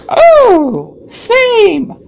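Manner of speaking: normal talking voice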